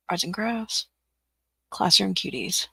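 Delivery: normal talking voice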